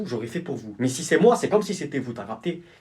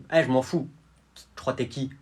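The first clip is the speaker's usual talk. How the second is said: ordinary talk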